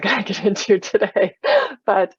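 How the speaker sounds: brisk, laughing aside. Real, not acted. Meditation host waves off a side topic to her group, laughing. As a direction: break into a real laugh while talking.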